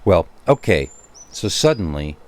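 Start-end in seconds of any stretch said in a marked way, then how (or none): none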